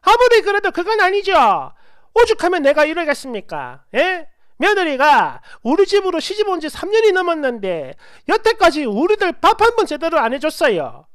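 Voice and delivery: loud, high-pitched indignant outburst; acted furious mother-in-law complaint; flipped-out rage. A narrator's reading. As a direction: explode at the in-law on the phone. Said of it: "이 할매가 눈깔이 뒤집어졌나 봐요".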